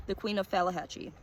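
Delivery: usual speaking voice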